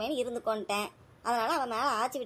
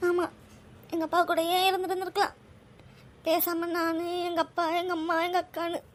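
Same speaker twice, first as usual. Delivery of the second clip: higher, tearful voice; tearful, missing her father; 5 semitones higher than usual. Real, not acted. Mother tells her son she misses her father, tearful and higher. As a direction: let the grief for her father break through. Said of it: "அம்மா, அழாதீங்க".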